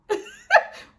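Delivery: high, loud laugh; squealing laugh; guilty laugh. Real, not acted. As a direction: laugh at your own confession. Laughing at confessing to mom.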